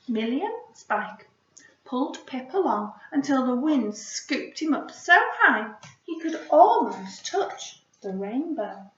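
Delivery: storybook narration, swelling as he flies up; story narration. A narrator's reading. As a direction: read it like a picture book to children. Reading story aloud.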